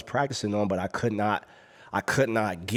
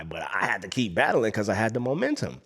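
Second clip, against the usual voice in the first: ordinary talk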